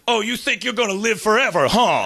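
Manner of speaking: high, taunting mock menace; off-screen challenger. Unseen voice surprises the salesman at home, taunting high. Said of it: "you hear an off-screen voice say".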